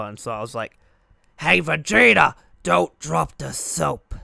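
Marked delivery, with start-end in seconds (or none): 1.4-4.1 loud, higher, rough put-on cartoon brute; Nappa. Kid joking around at recess as the character, loud impression. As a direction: bellow it in character, drawing out the last words. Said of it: "I did Nappa".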